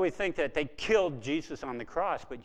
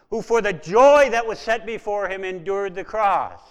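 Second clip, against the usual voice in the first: much louder, higher declaration; loud proclamation; 5 semitones higher, 14 dB louder than usual. Real, not acted. Preacher reading Hebrews aloud to the congregation, loud. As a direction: ring the verse out to the hall.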